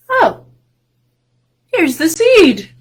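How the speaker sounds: high, loud happy cry; delighted exclaim. Acted. The boy finds the seed and exclaims, delighted.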